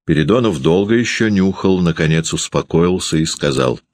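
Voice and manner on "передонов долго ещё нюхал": ordinary delivery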